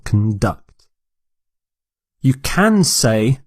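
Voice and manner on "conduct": "conduct" stressed on DUCT; stress on second syllable. Shown as a demonstration. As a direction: reduce the first syllable of "conduct", land on DUCT. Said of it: "the stress is on the second syllable".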